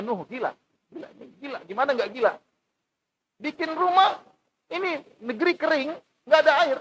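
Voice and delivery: high, jeering voice of the scoffers; mocking mob. Scoffers jeer at Noah building a boat, high mocking voice. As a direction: sneer at the prophet as a madman. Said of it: "Kata mereka".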